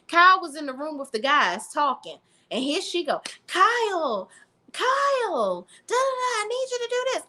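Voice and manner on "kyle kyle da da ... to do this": very high fast whiny nagging; mock whiny whining. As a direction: whine his name then rattle off demands, pitched way up. Imitating Amanda calling Kyle.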